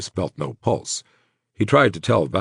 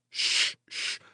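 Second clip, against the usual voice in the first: soft, breathed double shush; 8 dB quieter than usual. Acted. Friend calming the man who tried to sit up, soft shushing. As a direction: gently hush an injured friend.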